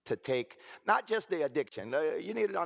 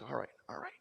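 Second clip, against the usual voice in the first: whispered all right, soothing; whispered reassurance; 3 semitones lower, 8 dB quieter, faster than usual. Retold or imitated. Soothing his sister, he whispers all right. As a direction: hush her gently in a whisper.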